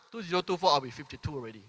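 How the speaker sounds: ordinary talk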